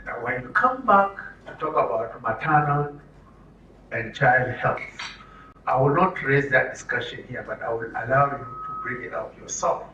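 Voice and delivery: usual speaking voice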